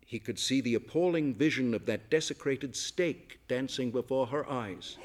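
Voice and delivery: ordinary delivery, nothing marked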